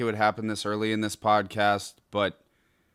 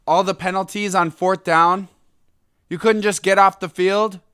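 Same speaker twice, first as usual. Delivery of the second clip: loud, raised, incredulous complaint; high-pitched exasperated disbelief; indignant; 7 semitones higher, 6 dB louder than usual. Real, not acted. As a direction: throw it out as an outraged question. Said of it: "It's so ridiculous".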